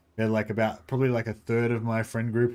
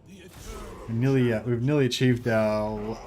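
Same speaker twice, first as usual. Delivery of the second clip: normal talking voice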